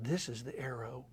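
grandson's line whispered low and slow; hushed whisper. Retold or imitated. Grandson showing the deer arrow to his grandfather, whispered. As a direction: breathe it like a hunter in the woods. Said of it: "He said".

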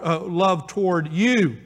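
normal talking voice

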